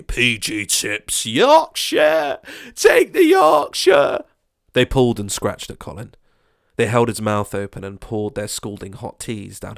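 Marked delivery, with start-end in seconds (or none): 0.0-4.2 high, loud, slow urging; menacing insistence. Acted. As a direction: drag it out, insist he takes it. Neighbour forcing tea.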